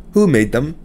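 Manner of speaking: lightly voiced immigrant mother, scolding; a hint of a stern mother chiding her son; mother's voice. A narrator's reading. Narrator voicing the mother, mildly scolding. Mother asking about the pies.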